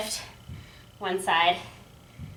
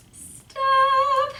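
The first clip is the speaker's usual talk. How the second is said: shouted stop; 12 semitones higher than usual. Real, not acted. Coach calls end of set.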